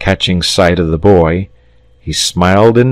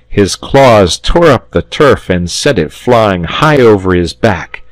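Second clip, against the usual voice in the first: usual speaking voice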